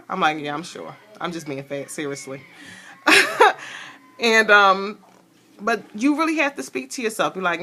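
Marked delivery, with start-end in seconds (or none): 0.4-2.4 low, soft retold reply; quiet admission. Customer assuring the drive-thru worker she's sure, quiet admission. 3.0-3.8 short high laugh; real burst of laughter, pitched high. Real, not acted. Woman amused by her own drive-thru story, short high laugh.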